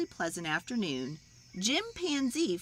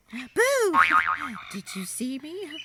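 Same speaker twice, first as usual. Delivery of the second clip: high, loud jump-scare shout; playful boo; 7 semitones higher, 5 dB louder than usual. Acted. Leslie springing a scare from behind a tree. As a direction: pop out and spook them. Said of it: "shouted Leslie".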